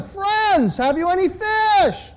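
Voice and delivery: high, carrying call across distance. A narrator's reading. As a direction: call out to people far off, pitched well up. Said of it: "He called out to them".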